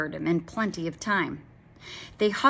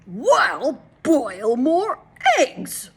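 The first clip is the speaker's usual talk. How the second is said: high, loud, slow bellowed order; roaring husband; 7 semitones higher, 8 dB louder, much slower than usual. A narrator's reading. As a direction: roar the order at your wife. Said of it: "roared Mister Wagon Wheel".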